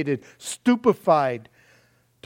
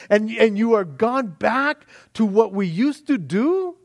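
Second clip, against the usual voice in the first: much higher, louder, pleading rebuke; a young man raging at his people's backsliding; indignant young man; 7 semitones higher, 4 dB louder than usual. Retold or imitated. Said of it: "can you imagine this young man going".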